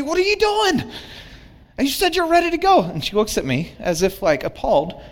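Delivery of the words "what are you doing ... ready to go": high, fast exasperated complaint; fed up at being kept waiting; frustrated. Retold or imitated. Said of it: "I get so frustrated, so angry".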